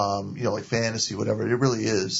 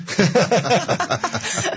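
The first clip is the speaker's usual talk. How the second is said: a bright burst of real laughter, higher pitched; 9 semitones higher, 3 dB louder than usual. Real, not acted. Guest laughing at herself as the host teases her, real laugh. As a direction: laugh it off, caught out. Laughs after host's teasing "Really?.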